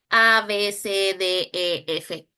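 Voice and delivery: letters recited one by one; A, B, C, D, E, F recited as a rote list; reciting letters. Shown as a demonstration. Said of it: "Es una memorización".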